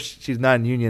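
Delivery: ordinary talk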